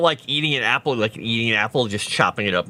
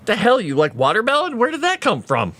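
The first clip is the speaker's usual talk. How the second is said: higher, incredulous mock outburst; surprised disbelief; 10 semitones higher than usual. Reacting to the cat eating watermelon, higher astonished disbelief. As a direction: act stunned at the cat. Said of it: "I'm like".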